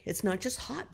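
usual speaking voice

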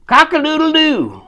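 high, loud cock-a-doodle-doo call; yelled rooster crow. Acted. Storyteller as a rooster waking the farm, yelling out. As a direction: crow it out to wake the whole farm. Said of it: "he would yell out".

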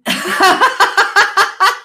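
high, loud burst of laughter; real cackling laugh, loud and high; big laugh. Real, not acted. Podcast guest cracking up over own confession, big high laugh.

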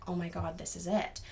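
hushed, low-key dread; retold dismay. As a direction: say it under your voice, imitating a sinking realization. Retells dismay on seeing the building.

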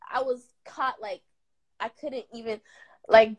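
ordinary talk

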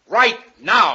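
sudden loud high threat; shouting his threat as he closes in; menacing shout. Acted. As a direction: lunge at her with the words. Frank advancing on her, threatening.

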